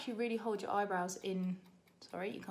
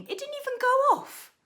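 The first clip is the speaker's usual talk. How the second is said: much higher and louder, outraged disbelief; 6 dB louder than usual. Real, not acted. To viewers, discovering her egg timer never rang, high outraged disbelief. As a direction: squeal it in total disbelief.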